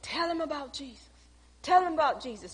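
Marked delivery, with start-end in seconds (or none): 0.0-1.1 soft, murmured plea under the breath; a quiet inner prayer, barely voiced. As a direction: pray it softly to yourself. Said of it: "And I'm sitting there saying".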